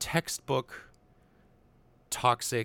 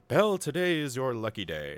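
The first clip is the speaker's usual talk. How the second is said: boastful, pushed up and louder; cocky Gaston; 5 semitones higher, 4 dB louder than usual. Retold or imitated. Gaston to Belle at her door, boastful. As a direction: puff up and lay it on thick. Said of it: "laying it thick on her".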